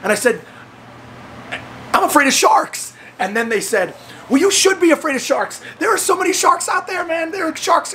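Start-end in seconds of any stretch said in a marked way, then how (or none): 1.9-2.9 high, emphatic frightened blurt; re-enacted scared outburst, high-pitched; fearful confession. Retold fearful confession to friends. 4.3-7.9 high, urgent scare talk; retold alarmist warning, pitched up; mock warning. Retold friends scaring him about sharks.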